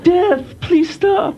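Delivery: mock anguished cry. Listener reacting to Aussie voice.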